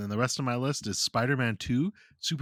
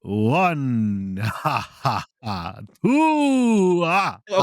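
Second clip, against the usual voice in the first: loud, gloating vampire count with ha-ha laughs; hammed-up vampire, each number crowed out; Count Dracula counting; 5 semitones higher, 8 dB louder, much slower than usual. Co-host shows they can count, loud mock Dracula voice.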